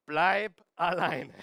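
slow, loud chanted Bleib alleine; slow call-out. Shown as a demonstration. Preacher leading congregation to repeat, slow loud call. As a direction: say it together with the crowd. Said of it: "Sagen wir das gemeinsam".

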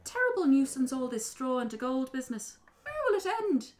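high, fussy leprechaun voice; exasperated little creature; grumbling little man. Acted. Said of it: "He walked around the room".

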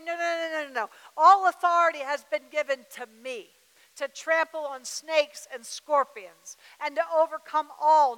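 loud, forceful rebuke of the enemy; bold declaration. Shown as a demonstration. Preacher showing the church how to rebuke the enemy, loud and forceful. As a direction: proclaim it like you mean it.

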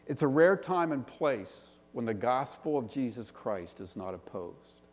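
normal talking voice

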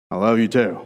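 low, understated "I love you too"; matter-of-fact, low-pitched reply; dutiful reply. Acted. Man answering by logic to please her, dutiful. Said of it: "So then we go".

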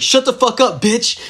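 high, punchy imitated retort; mock defiant yell. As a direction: throw it back at the cop, mock-angry. Friend's retort to chasing cop.

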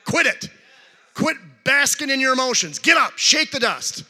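loud, high-pitched pep talk to oneself; stern self-command. Retold or imitated. Preacher retelling orders to himself, loud and commanding. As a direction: order yourself up, loud and emphatic. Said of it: "I've said to myself".

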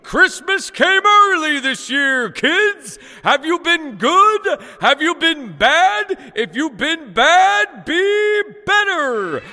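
big high booming Santa bellow, drawn out; loud, stretched-out Ho-ho-ho showman holler. Acted. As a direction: belt it out slowly to a crowd of kids. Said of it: "I bellowed".

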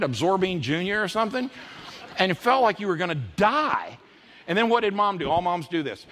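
ordinary delivery

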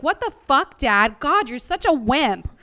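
high, loud exasperated outburst at a dead father; mad yelling at dad. Retold or imitated. Angry daughter yelling at her late dad during readings. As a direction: snap at him like a fed-up kid. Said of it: "'cause I just got mad".

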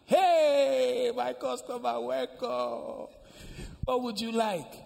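high, loud sing-out to a customer; shopkeeper's gushing welcome. Acted. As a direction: hail a customer with a drawn-out, high call. Shopkeeper greets her customer in story.

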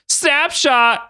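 high-pitched gleeful cry; mock scream. A narrator's reading. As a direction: call it out in a high squeal. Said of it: "Ryan screamed".

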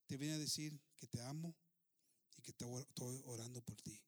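soft, low, tender message; gentle sincerity. Acted. Widow to her husband's killer, gentle sincerity.

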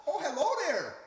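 put-on folksy upper Midwest accent; friendly Minnesota nice. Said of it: "what's with his crazy accent".

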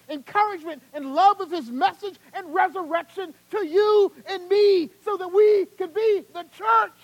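high, very loud, building sermon climax; impassioned preaching. Real, not acted. Preacher proclaiming the suffering Lord to the church, impassioned. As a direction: drive each phrase home, stretching key words.

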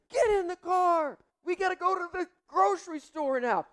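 high, pressing dad command; urgent order. Retold or imitated. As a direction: hurry him out the door.

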